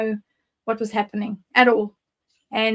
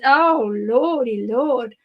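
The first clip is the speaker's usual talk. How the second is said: exasperated sigh; much slower than usual. Real, not acted. Exasperated memory of the belt.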